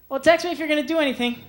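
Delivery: put-on high, chirpy girl voice; girl texting. Girlfriend texting her boyfriend from lunch, high and chirpy. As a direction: play the bubbly girlfriend opening a chat. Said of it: "First one's from the girl".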